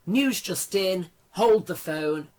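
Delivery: loud, punchy bulletin announcement; news announcer. Pretend. Mock breaking news announcement.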